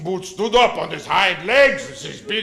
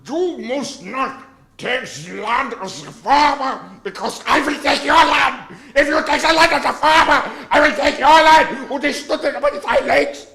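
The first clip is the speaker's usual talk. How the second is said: mock German dictator rant, building louder; thick German accent, stretched then ranting higher; thick Hitler accent; 3 semitones higher, 6 dB louder than usual. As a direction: ham up a Hitler-style German speech. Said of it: "Thicken the accent so Hitler".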